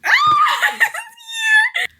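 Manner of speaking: very high shrieky laugh; excited squeal of laughter. Real, not acted. Flustered reaction to character's reply.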